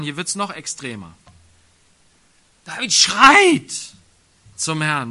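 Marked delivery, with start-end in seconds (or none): 2.6-3.7 much higher and louder, slow on schreit; shouted emphasis. Acted. Preacher shows how David cries to the Lord, loud slow shout. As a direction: belt it out like a cry.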